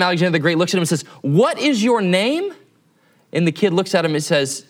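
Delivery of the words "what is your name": slow, higher-pitched, drawn-out question; stern demand. Retold or imitated. Alexander confronts the soldier one more time, stern demand. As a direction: space out every word, rising hard at the end. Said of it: "his voice is a little sterner".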